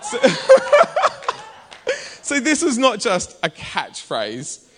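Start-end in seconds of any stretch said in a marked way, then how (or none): 0.2-2.2 burst of laughter. Real, not acted. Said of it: "laughs at himself".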